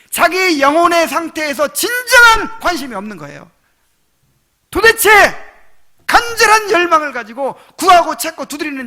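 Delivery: high, loud, impassioned sermon; full-force pulpit preaching; fiery preaching. Real, not acted. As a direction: preach it out with rising urgency.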